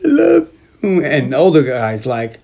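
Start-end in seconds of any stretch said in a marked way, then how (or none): 0.1-1.0 high, drawn-out mock drunk wail; hammed-up sloppy drunk, wailing an apology; fake drunken crying. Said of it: "he fakes like he's drunk, like, super drunk, and he's crying".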